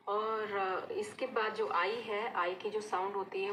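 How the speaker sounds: normal talking voice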